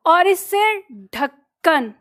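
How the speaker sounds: ढक्कन drawn out, ढ with a breathy push; slow ढक्कन. Shown as a demonstration. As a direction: stretch ढक्कन and stress the ढ. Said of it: "ढक्कन जब बोलते हो तो ढ". (ढक्कन को धीरे से, ढ पर ज़ोर)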